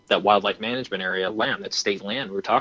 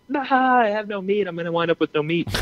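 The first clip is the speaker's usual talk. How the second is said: high, far voice, faster on the second line; hammed-up panicky whine about no meat; a touch of mock despair; 9 semitones higher than usual. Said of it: "I'm dying now 'cause I'm like".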